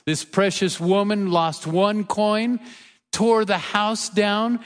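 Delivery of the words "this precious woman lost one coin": ordinary delivery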